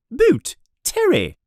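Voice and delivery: high, ringing name call; teacher calling the first pupil. Acted. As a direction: call the name across the hall.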